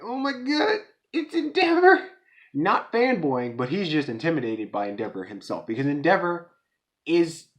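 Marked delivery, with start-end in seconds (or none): 0.0-2.1 high squeaky panicked gasp, getting louder; starstruck panic. Retold or imitated. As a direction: squeal it like a scared fan who just saw his hero. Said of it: "who's shaking at his boots like".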